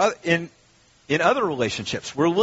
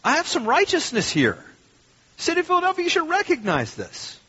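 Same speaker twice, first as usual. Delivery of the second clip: higher, self-righteous demand on the city, peaking very high; mock demanding; 12 semitones higher than usual. Pastor imitating his demand on the city, higher entitled tone. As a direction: insist you deserve credit. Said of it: "You know, I was like".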